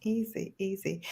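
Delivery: soft breezy boast; smug soft easy. Real, not acted. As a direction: brush off the praise softly.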